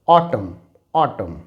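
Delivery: ऑटम said as autum, no n; silent n demo. Shown as a demonstration. Teacher showing students the silent n in ऑटम. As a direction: end ऑटम on m. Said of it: "तो हम इसे पढ़ेंगे".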